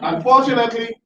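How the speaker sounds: ordinary delivery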